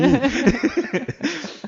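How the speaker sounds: a high, bursting laugh. Real, not acted. Laughing about the upset student in class. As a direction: laugh at the memory.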